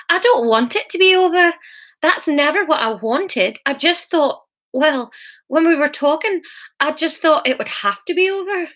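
high-pitched, bewildered protest; confused, disbelieving argument. A narrator's reading. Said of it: "argued through a blur of confusion and disbelief".

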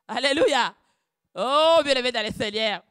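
loud, high call to the faithful; fervent sermon shout; preaching cry. Acted. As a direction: lift the congregation. Preaching outburst.

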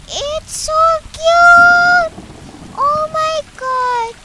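high, drawn-out squeal of delight; cute-gushing squeal. Real, not acted. Player melting over a cute game cat, high gushing squeal. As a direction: coo it very high, stretching each word.

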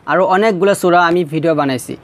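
normal talking voice

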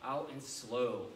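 slow, drawn-out cue; slow count. Shown as a demonstration. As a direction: count it out slowly for the strikes. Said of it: "Let's go some palm heel strikes".

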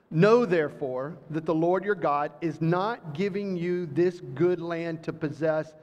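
usual speaking voice

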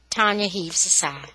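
ordinary delivery, nothing marked

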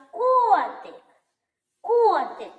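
high, loud call of "Котик", long first О. Shown as a demonstration. Teacher calls the kitty to find stress, loud calling "КООтик". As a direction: call the cat, КООО-тик. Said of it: "Давайте покличемо котика".

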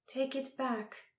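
high, slow, cool command; a touch of cold detachment. A narrator's reading. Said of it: "her voice cool".

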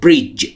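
"Bridge" said cleanly, the d melting into the j sound; careful pronunciation. Shown as a demonstration. Teacher showing Persian learners how to say "Bridge", clear and careful. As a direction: spell out "Bridge" so the middle d is not heard on its own. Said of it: "اون دی وسطش هم تلفظ نمیشه".

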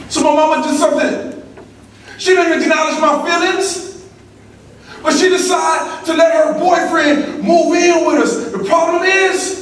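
high-pitched, drawn-out storytelling shout; full-force testimony. Real, not acted. Speaker tells a crowd about mama's boyfriend, in full-force testimony. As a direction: build the trouble in a high, ringing voice.

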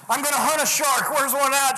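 mock gung-ho shark hunter; retold eager aggression. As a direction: imitate a pumped-up guy looking for a fight. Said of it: "changed from fear to aggression".

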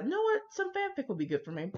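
a playful imitation of a fan; mock fan voice. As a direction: tease the late-night fanfic reader. Mocking fans who crave fanfic.

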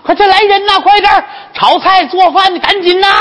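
high, excited shouting for servants; gush, then holler orders at top pitch; overjoyed calling out. Acted. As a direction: jubilant madam summoning a feast. Overjoyed madam calls for a feast.

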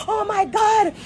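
hammed-up shouting Erica; mock screaming. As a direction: imitate her screaming a scene. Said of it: "I scream down the place".

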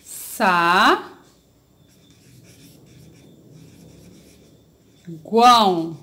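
SAGUÃO broken into SA, long pause, GUÃO; syllable by syllable. Shown as a demonstration. Teacher separating a word for the class, syllable by syllable. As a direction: hold "Sa", stop, then say "guão". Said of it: "Vamos separar".

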